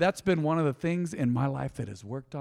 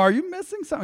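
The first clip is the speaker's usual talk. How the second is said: squeaky, much higher, laughing disbelief; mock disbelief; 4 dB louder, much faster than usual. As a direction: ask like you can't believe your ears. Mock-incredulous reply to the big guy.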